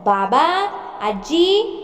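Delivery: calling out. Acted. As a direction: yell for the elders to come. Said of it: "Raghu ओरडत होता".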